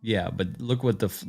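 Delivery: ordinary talk, nothing marked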